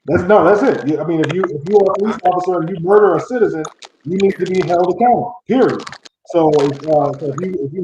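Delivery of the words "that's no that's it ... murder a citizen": ordinary talk, nothing marked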